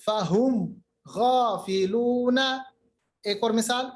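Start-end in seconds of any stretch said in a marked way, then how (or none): 0.0-2.4 slow recital, drawn vowels in غَافِلُونَ; clear mim. Shown as a demonstration. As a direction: close فَهُمْ on a clean, unheld mim. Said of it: "بالکل بھی دیری نہیں کرنی". (تجوید سے اظہار کی مثال)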